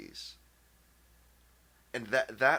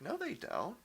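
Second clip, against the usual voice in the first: flat retort; 10 semitones higher, 5 dB quieter than usual. Retold or imitated. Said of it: "And I'm like".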